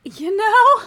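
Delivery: crying with laughter; laughing to tears. Real, not acted. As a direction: laugh till you cry.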